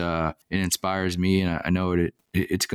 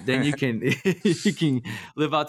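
chuckling through the words, higher; laughing while talking; 6 semitones higher than usual. Real, not acted. Host teasing the guest about retirement, laughing. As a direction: let the laugh bubble through the joke.

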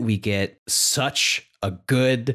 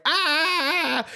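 mock scream. Mock scream of game character.

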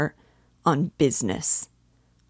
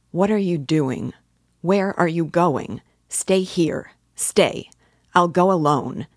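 louder, rising agitated cry; cowardly vexation; 2 semitones higher, 6 dB louder than usual. A narrator's reading. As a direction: snap at her to stay behind out of fear. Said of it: "he cried in cowardly vexation".

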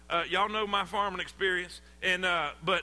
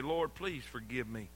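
quiet prayer; 7 semitones lower, 7 dB quieter than usual. Retold or imitated. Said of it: "we never say".

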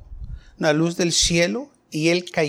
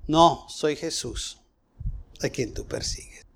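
hushed divine voice; 4 semitones lower, 6 dB quieter than usual. Acted. Voice of Jesus answering Saul.

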